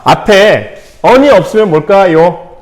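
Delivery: ordinary talk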